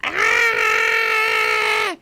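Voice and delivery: shrieking high falsetto hello then a scream; a big man playing grandma, haunting; Madea voice. Retelling the horrifying Madea scene, falsetto shriek. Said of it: "grandma outfit talking about some".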